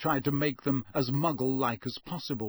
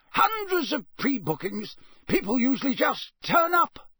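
high, loud country voice; a wary old Scots countryman; rustic campsite owner; 10 semitones higher, 4 dB louder than usual. Acted.

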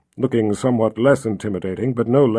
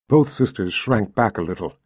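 ordinary delivery, nothing marked

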